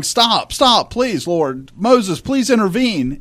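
high, loud frantic begging; imitating Aaron begging for mercy; pleading Aaron. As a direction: beg God to stop, voice up high. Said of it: "Aaron's going".

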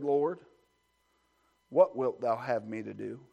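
a hushed, trembling plea, dropped low and soft; Saul's awestruck question, low and hushed; trembling and astonished. Acted. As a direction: ask it shaking with awe. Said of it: "trembling and astonished".

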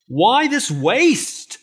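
high, loud and slow, stretched-out outrage; mock indignant cry. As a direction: drag out the scornful protest. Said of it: "they were indignant".

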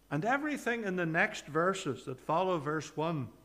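normal talking voice

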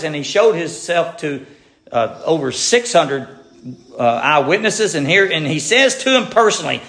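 usual speaking voice

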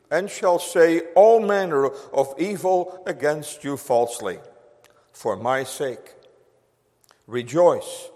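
a hint of solemn recitation; scripture reading. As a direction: read the verses reverently, measured. Reading scripture aloud in sermon.

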